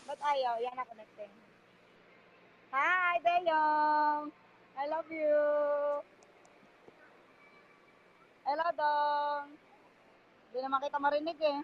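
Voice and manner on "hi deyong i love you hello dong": long, held final vowels; drawn-out greeting. Real, not acted. Greeting someone on a call, drawn-out hello. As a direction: call it out, stretching the last syllable.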